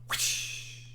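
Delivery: breathy unvoiced whoosh like a whip swing; airy mock whip crack. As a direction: swish an imaginary whip at him. Urging chat on, mock whip.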